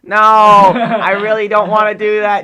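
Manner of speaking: high, very loud, slow whine; hammed-up whiny teenage protest; Hiccup whining. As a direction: drag it out, pleading nasally. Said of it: "He's really whiny".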